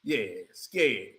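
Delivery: a low teasing drone; deep mock voice. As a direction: sneer it from way down low. Deep mocking voice about Brunson.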